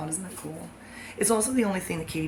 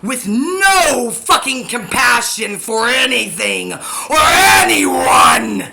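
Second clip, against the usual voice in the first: much louder, ending in a high raw scream; screaming climax; 4 semitones higher, 11 dB louder, much slower than usual. Real, not acted. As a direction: lose it completely into a scream. Rant peaks in a scream.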